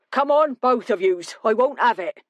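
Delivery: stern bartender. Acted. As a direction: break up the fight, firm and high. Bartender breaking up a bar fight.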